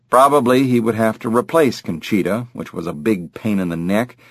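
ordinary delivery

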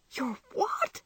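high, incredulous question; astonished cry. A narrator's reading. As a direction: squeak it out in disbelief. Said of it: "cried Jo".

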